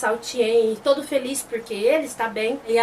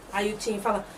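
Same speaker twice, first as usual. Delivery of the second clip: ordinary delivery, nothing marked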